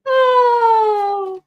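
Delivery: sudden loud high oh; startled shout; surprised cry. Real, not acted. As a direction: yelp in surprise. Sudden cry mid reading.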